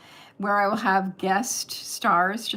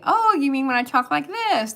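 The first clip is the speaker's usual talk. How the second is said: higher-pitched mock German accent; put-on German accent; 5 semitones higher, 4 dB louder than usual. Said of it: "I would put on the accent".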